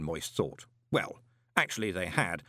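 usual speaking voice

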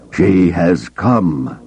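ordinary delivery, nothing marked

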